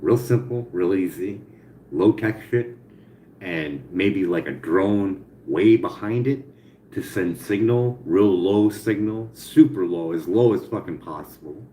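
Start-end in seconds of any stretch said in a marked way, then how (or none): none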